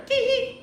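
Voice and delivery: short, very high giggle; suppressed giggle. A narrator's reading. Bismarck failing not to laugh, suppressed giggle. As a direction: let a tiny squeal of giggle escape.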